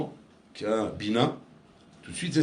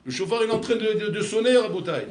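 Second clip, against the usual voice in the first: eager objection; 7 semitones higher, 4 dB louder than usual. Retold or imitated. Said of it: "Donc tu vas dire".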